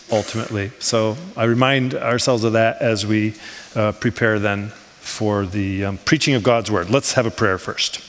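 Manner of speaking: ordinary talk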